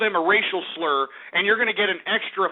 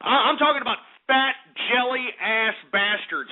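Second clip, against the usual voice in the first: ordinary talk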